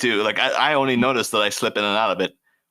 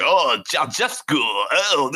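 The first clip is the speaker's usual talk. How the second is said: mock Eastern European; 3 semitones higher, much slower than usual. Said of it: "he was just like".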